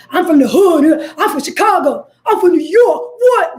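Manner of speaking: loud, high, chest-out boast. Retold or imitated. Mocking braggers who shout where they're from. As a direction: shout it like a badge of honor. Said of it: "I don't say it with that energy".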